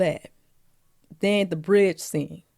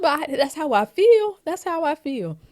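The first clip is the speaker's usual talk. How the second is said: high voice, playfully emphatic; high-pitched insistence; 9 semitones higher, 3 dB louder than usual. Pretend. Fan siding with Willie, high-pitched insistence. Acts out own reaction, high voice.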